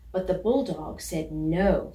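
ordinary delivery, nothing marked

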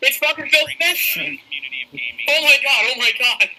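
high-pitched fan shriek; shrieking jackpot winner. Real, not acted. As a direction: squeal with joy at seeing your idol. Fan sees his idol appear.

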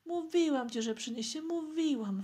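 put-on high, excited girl's I-told-you-so; little sister chiming in. A narrator's reading. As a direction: chime in high, loud at first. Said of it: "wtórowała mu siostrzyczka". (dziecięce, triumfalne wtórowanie)

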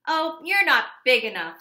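loud high scoff; loud scoffing ladybug; dismissive. Acted. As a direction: brush them off.

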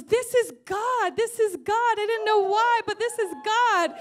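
high, loud retold declaration; overwhelmed exclamation. Preacher voices the crying astrologist realising it is God, overwhelmed exclamation. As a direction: cry it out as a sudden revelation.

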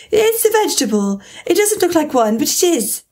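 put-on high, eager young girl; bright childish insistence. A narrator's reading. Alice voiced.

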